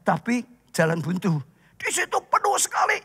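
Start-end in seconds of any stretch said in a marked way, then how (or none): 1.9-3.0 preaching at full force. Acted. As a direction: drive the story home to the congregation. Preacher builds the crowded house scene.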